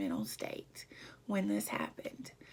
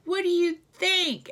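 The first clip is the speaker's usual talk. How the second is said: much higher, louder retold cry of protest; upset outburst; 9 semitones higher, 9 dB louder than usual. Wife in tears firing back at her husband, upset outburst. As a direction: throw it back at him, voice raised high. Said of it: "I'm crying".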